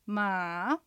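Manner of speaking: ま dipping low, then rising; third tone. Shown as a demonstration. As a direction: sink ma low and soft, then lift ま. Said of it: "続けて四つ発音しましょう". (ま を低く抑えてから上げる第三声)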